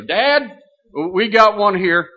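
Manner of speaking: high, loud call to the husband. Retold or imitated. Wife calls her husband to the desk, loud high call. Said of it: "she called Roscoe Brong".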